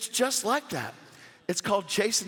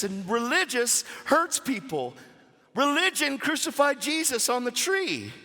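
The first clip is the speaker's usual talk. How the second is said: higher, driving retold rebuke; impassioned preaching; 6 semitones higher than usual. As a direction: preach it with rising conviction. Retelling his rebuke with preaching force.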